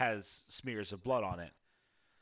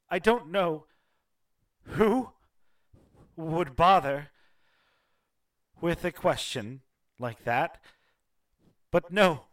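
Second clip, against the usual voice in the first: loud, higher, grief-torn shout; anguished outburst; 6 semitones higher, 10 dB louder than usual. Acted. Grieving widow shouting at an intruding stranger, anguished. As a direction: she snaps out of her grief and yells.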